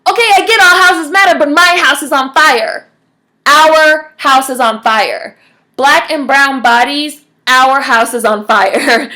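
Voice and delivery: loud, emphatic exasperation, slowing word by word; acted fury. Homeowner telling firefighters whose house is burning, furious. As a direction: start high and loud, then hammer it out slowly. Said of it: "You would be furious".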